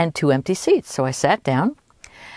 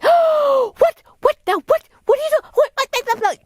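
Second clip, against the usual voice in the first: imitated very high, frantic squawking; flustered outburst; 4 dB louder than usual. Woman realizing she was swindled as passenger leaves, frantic high sputtering. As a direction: sputter it at the very top of your pitch. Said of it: "This kind of thing".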